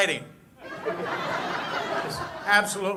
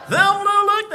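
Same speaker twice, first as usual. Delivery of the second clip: much higher, louder squeak on Thelma; squeaky high Barney; 9 dB louder, slower than usual. Acted. Deputy squeals out his girlfriend's name in a high, loud voice. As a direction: shoot the voice up in a nervous squeak. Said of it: "Barney Fife".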